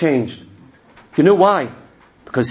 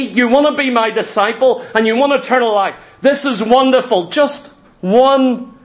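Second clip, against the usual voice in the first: eager Jesus; 8 semitones higher than usual. Acted. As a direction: gush over him like a delighted teacher. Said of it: "What did he say to the rich young ruler?".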